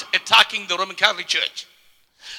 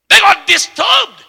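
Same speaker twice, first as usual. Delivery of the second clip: slow, high, loud declaration; a slow, high shout over the crowd; preaching shout; 11 semitones higher, 8 dB louder, slower than usual. Real, not acted. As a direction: thunder it out to the congregation. Preacher's climax on opposition.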